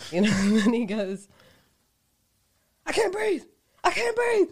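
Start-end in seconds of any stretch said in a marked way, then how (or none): 2.9-4.5 high, strained mock cries; imitated yelling. Drunk gambler answering a request to mask up, imitated yelling. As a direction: yell it like a drunk making a scene. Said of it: "yelling this".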